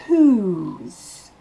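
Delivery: "Whose" with a long ou. Shown as a demonstration. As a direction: stretch the ou in "Whose". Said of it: "le O se prononce ou". ("Whose" avec un ou long)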